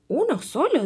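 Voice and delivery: high, loud disbelief; surprised question. Acted. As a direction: ask it amazed. Princess asks surprised.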